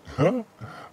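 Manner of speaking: a quick bright giggle; real amused giggle; short laugh. Real, not acted. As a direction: let a little laugh slip out. Reacting to chat high fives.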